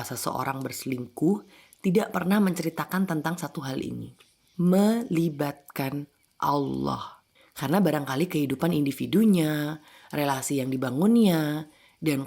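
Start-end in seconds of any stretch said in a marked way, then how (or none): 4.6-7.2 very slow, deliberate delivery; slow reveal. Real, not acted. Narrator revealing the one overlooked thing, slow and deliberate. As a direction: draw the words out slowly and deliberately.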